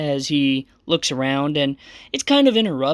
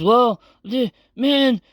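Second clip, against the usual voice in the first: put-on high, slow slurred drunk voice; a drunk samurai in a higher voice; 8 semitones higher, slower than usual. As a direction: slur it like a drunk. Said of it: "And he says".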